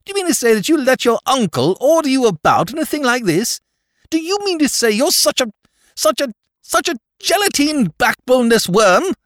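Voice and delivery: high, loud indignant outburst; outraged tirade. A narrator's reading. Jimmy berating Drever for obeying his uncle, loud outrage. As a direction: blast him with contempt.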